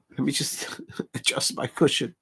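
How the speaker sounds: a breathless whisper with a small laugh; whispered aside. Real, not acted. Streamer fussing with his cushion, whispered with a laugh. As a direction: mutter it under your breath to yourself. Aside while shifting seat.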